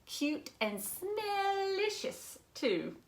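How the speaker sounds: high, slow, doting; fond high cat thought; adoring cat voice. A narrator's reading. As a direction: gush over the babies.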